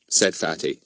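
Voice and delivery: ordinary delivery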